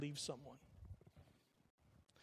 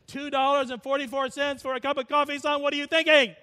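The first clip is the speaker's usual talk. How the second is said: very loud, high-pitched scolding father; outraged dad; 10 semitones higher, 14 dB louder than usual. Retold or imitated. Late dad scolding son over coffee price, outraged. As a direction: holler it like an appalled dad. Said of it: "He's in my head. His voice".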